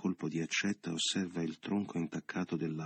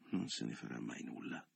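despairing thought; 7 semitones lower, 11 dB quieter than usual. A narrator's reading. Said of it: "pensò disperato".

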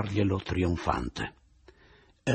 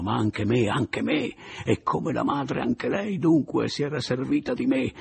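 indignazione; 6 semitones higher, 3 dB louder than usual. A narrator's reading. Narratore furioso contro Romilda.

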